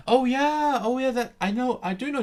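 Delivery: light up at a name you know; excited recognition. Real, not acted. Learner hears a familiar name, excitedly high and loud.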